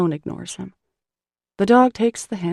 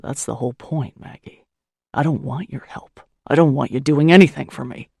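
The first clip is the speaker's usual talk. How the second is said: lower, hard, punching the refusal; weary man refusing her help, lower, rising to loud; cold gruff man; 4 semitones lower, 5 dB louder than usual. Acted. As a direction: lay it down flat and final. Malone final refusal.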